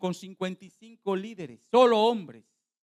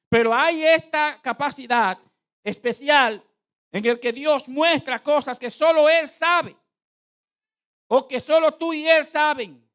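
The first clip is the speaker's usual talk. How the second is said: high, loud, emphatic pulpit voice; full-force preaching; 7 semitones higher, 8 dB louder than usual. Real, not acted. Preacher teaches the church what prophecy is, full-force preaching. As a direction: hammer the teaching home to the congregation.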